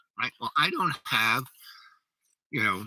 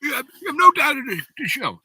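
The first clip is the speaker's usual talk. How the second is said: high, fast mock outburst; losing his mind; 7 dB louder than usual. Friend rejecting what he tells him, mock losing his mind. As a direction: sputter it out fast, pitched way up, imitating an outraged friend. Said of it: "he, like, loses his mind".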